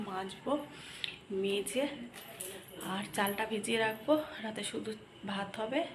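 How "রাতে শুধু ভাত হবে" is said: ordinary talk, nothing marked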